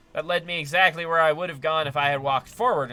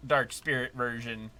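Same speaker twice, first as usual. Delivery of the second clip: usual speaking voice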